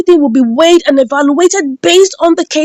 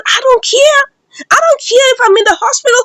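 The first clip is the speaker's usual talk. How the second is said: high-pitched acted outburst; screaming and shouting; 9 semitones higher than usual. Jeff to his mother in the hospital, screaming and shouting. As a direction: act out a son yelling, pitch jumping up. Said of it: "screaming and shouting".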